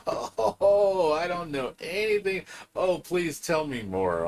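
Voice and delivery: high, sing-song sarcasm that starts with a cry and slows to a drawl; sarcastic mock innocence. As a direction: play dumb to mock the know-it-all. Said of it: "I'm like".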